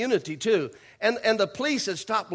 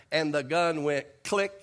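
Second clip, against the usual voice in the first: ordinary talk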